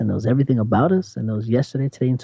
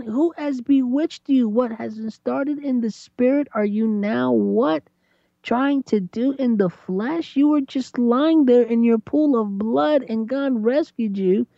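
much higher, stunned voice; incredulous God; 11 semitones higher than usual. Retold or imitated. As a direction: demand what happened in disbelief. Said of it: "He's like".

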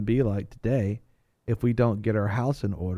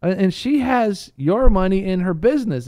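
Jimmy Stewart pleading; 9 semitones higher, 7 dB louder than usual. Retold or imitated. Banker on the counter calming the crowd, raised pleading voice. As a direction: point and plead over the noise. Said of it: "he goes".

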